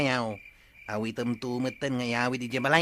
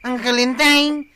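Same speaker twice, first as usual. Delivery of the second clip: loud high scolding; angry outburst; 11 semitones higher, 12 dB louder than usual. Acted. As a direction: snap back in anger. Quarrel breaks out.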